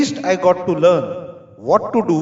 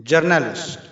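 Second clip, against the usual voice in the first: usual speaking voice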